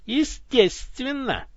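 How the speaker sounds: slow, high sarcastic drawl. Acted. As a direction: drip with sarcasm. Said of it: "не скрывая сарказма". (саркастично, медленно)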